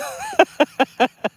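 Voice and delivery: a burst of high-pitched laughter; amused high laugh. Real, not acted. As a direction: laugh out high and bright at the joke. Laughs at a chat joke.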